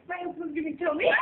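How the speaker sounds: normal talking voice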